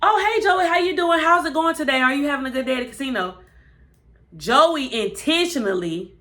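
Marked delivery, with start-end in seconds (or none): 0.0-3.4 high, bright, fake-friendly greeting, speeding up at the end; fake cheerful. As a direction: pile on the sweetness, then rush it. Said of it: "So then Deanna".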